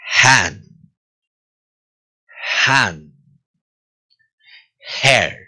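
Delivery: "Hand" begun with a puff of breath; "Hair" with audible h friction; breathy H drill. Shown as a demonstration. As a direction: model "Hand" and "Hair" one by one, strongly aspirated. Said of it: "como si estuviese haciendo un bollito".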